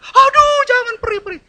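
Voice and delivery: high, loud yelp of acted pain; mock pain cry. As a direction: cry out as if pinched hard. Acting being pinched harder.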